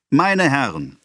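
the councillor hailing the crowd, high and loud; orator's address. A narrator's reading. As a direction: address the gentlemen grandly. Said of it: "fuhr der Redner fort".

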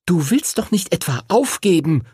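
put-on high, indignant girl; snapping at him. A narrator's reading. Lucy confronts Henry about giving up, high and indignant. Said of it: "fuhr sie ihn an".

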